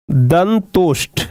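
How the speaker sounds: दंतोष्ठ drawn out at half speed; slow dictation. Real, not acted. Teacher repeating a new term for students, slow dictation. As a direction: say दंतोष्ठ slowly, syllable by syllable.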